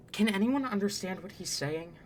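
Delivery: ordinary talk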